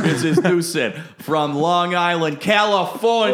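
put-on high, slow Long Island accent; laying on a thick New York accent; Jersey accent. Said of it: "This is a terrible".

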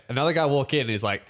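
normal talking voice

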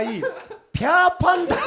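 high, loud, drawn-out Nagoya dialect. Shown as a demonstration. Announcing the Nagoya dialect version, high and slow. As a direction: stretch it out in mock Nagoya dialect, laughing. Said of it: "名古屋弁に入れた場合、".

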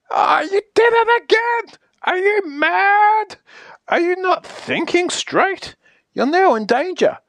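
very high, appalled wail; hammed-up panic, stretched and high; hysterical outrage. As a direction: shriek in disbelief at being defied. Outraged as page turns again.

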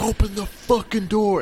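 high mock police demand; angry cop shout. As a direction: bang on the door as a cop. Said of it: "they're like".

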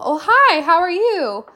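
high, chirpy cashier hello; bubbly drive-thru worker voice; gleeful greeting. Retold or imitated. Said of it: "I get a gleeful".

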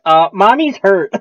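lightly voiced small boy; little boy voice. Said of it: "he goes".

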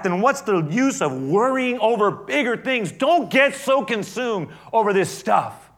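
loud, high, driving sermon voice; full-force preaching. Acted. Pastor preaching to his congregation, full force. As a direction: preach it to the back row.

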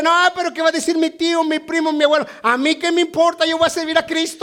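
high, mock worried relative talk; voiced churchgoer. Acted doubter then resolve. (creyente indeciso imitado, voz aguda)

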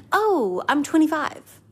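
high, whiny self-pity on Oh, I'm twenty-five; mocking inner voice. Mocking her own inner voice on turning twenty-five, whiny and high. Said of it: "my mindset has been".

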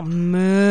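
playful cow moo. Pretend. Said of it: "I have mooed well over one hundred".

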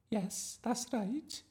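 high, slow teacher voice; patient drama teacher. A narrator's reading. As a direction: humour the child in a high, drawn-out voice. Voices teacher Miss Darling.

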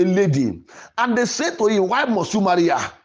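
normal talking voice

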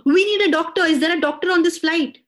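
high, loud, urgent shout; shouting for help. Retold or imitated. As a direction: call out for a doctor. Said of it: "जोर से चिल्लाया".

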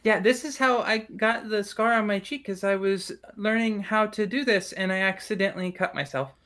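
put-on high child voice telling a story; high-pitched kid confiding a mishap; little elven girl. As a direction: explain it matter-of-factly as a small girl. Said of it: "little girl pops her head up".

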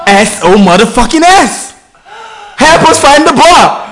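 slow, high shout for help; mock screaming. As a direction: yell it like you're stranded. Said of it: "We were screaming".

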